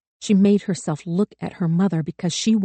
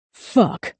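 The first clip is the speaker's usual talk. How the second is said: angry curse; 5 semitones higher, 4 dB louder than usual. A narrator's reading. Gunman's outburst.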